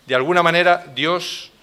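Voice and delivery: usual speaking voice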